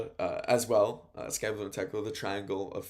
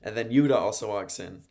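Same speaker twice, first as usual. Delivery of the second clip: ordinary delivery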